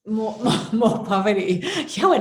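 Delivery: laughing loudly through the words; real laughter. Real, not acted.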